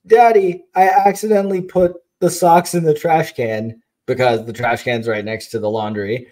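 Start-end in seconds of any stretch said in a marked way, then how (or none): 0.0-3.8 put-on worried little girl. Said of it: "she turns around and she goes".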